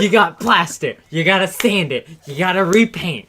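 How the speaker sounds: loud, high outraged homeowner; angry homeowner. Acted. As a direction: rant like a fed-up homeowner. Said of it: "It's part of the joke".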